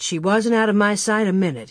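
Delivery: usual speaking voice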